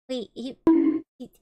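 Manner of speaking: ordinary delivery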